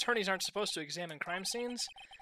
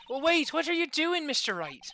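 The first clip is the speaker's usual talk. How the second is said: put-on high, loud, alarmed girl detective; put-on character voice; 9 semitones higher, 8 dB louder than usual. Young detective stopping Mister Wright pocketing evidence, high alarm. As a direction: break in high and loud, dropping on the name. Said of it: "I'm doing the Irish voice this well".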